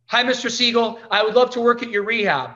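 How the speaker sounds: eager. Retold or imitated. Eager approach to rehab owner.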